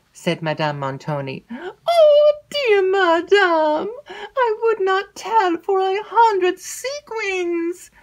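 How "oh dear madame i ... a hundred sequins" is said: very high, slow, tearful wail; frightened maid begging her mistress; crying out. Acted. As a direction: plead in alarm, voice cracking. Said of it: "cried Annette".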